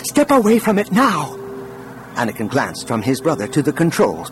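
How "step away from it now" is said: high-pitched, urgent command; urgent alarm. A narrator's reading. Brother orders him away from the controls, urgently.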